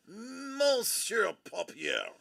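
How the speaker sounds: slow, savoured mock French Monsieur; mock French accent. Shown as a demonstration. Streamer greets the viewer as Monsieur in a mock French accent. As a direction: drawl it out like a French waiter. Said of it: "in French".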